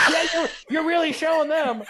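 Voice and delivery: high, laughing fake cheer; laughing mock encouragement. Prankster cheering on the duped friend, laughing sarcasm. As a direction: egg him on through your own laughter.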